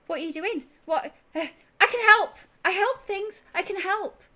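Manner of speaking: high, loud, bouncing eagerness; eager excitement. Acted. Tyne bounding over to offer help, high excited voice. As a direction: burst in begging to be included. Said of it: "bounding over".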